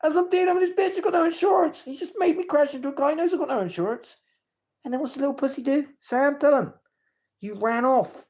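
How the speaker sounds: high, loud, heated outburst; furious rant. Real, not acted. Calling out Sam on video for the car crash, furious and loud. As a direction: let the anger boil over as you tell it.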